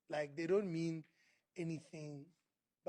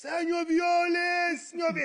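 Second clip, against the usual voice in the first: high, loud gushing call of his name; eager fan greeting; 12 semitones higher, 13 dB louder than usual. Retold or imitated. Fan spotting the rapper, calling his name with loud delight. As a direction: call out to him like an overjoyed fan.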